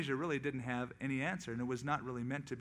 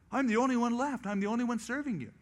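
higher and louder, insisting he alone is faithful; mock indignant Elijah; 8 semitones higher, 6 dB louder, faster than usual. Elijah complaining to God, higher and louder in mock protest. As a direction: protest your own loyalty.

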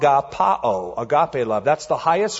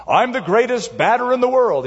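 put-on a little boy's proud, higher announcement to an empty yard; boyish announcement; 9 semitones higher, 4 dB louder than usual. Little boy alone in the backyard, proudly announcing himself. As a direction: proclaim it like a kid playing ballgame. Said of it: "he announced".

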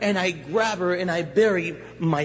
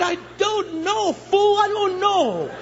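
high, stretched-out exasperated holler; comic high-pitched outburst; frantic yelling back; 12 semitones higher, 4 dB louder, slower than usual. Acted. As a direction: wail it back, helpless and frantic. He yells back at the dopers.

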